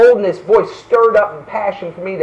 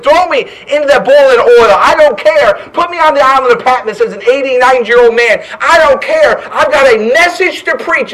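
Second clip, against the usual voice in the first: defiant; 5 semitones higher, 5 dB louder than usual. Acted. John defies his persecutors.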